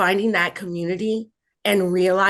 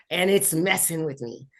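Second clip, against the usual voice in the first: normal talking voice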